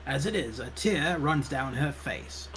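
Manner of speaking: drawn-out storytelling read; game narration. A narrator's reading. Narrator tells of librarian's tearful relief, slow story narration. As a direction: narrate it slowly, with drama.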